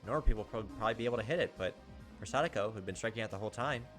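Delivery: usual speaking voice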